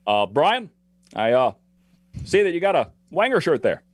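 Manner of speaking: paced like Obama, lead in and dismount; Obama impression. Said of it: "I can do an Obama".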